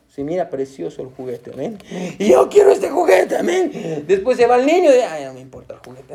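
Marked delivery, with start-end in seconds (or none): 2.2-3.4 put-on high-pitched kid demanding a toy; covetous child. Preacher mimics a child wanting his friend's toy, high eager whine. 5.0-6.1 low, soft, uninterested grumble; dismissive child. Acted. Preacher mimics a child after the friend leaves, quiet indifference.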